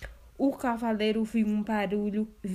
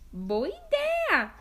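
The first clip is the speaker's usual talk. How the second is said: much higher, slow delighted cry; delighted girl; much slower than usual. Acted. Girl agreeing eagerly to pick flowers. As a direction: sound thrilled by the idea. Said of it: "disse Chapeuzinho".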